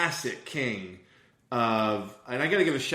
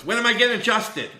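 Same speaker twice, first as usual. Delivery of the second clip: put-on high, louder eager little boy; eager kid voice; 10 semitones higher, 7 dB louder than usual. Son Ollie bursting into the room, eager and higher. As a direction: chirp it like an excited kid. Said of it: "Ollie walks in goes".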